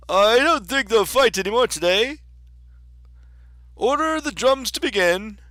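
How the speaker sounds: high, loud storybook king. Acted. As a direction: proclaim it as a king.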